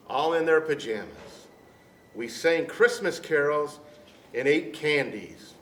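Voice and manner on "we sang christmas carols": plain reading voice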